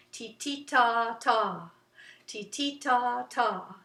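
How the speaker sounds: two short T's, two long ta's; rhythm syllables. Shown as a demonstration. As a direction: chant slowly in even rhythm. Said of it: "went on for a while too".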